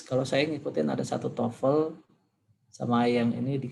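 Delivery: usual speaking voice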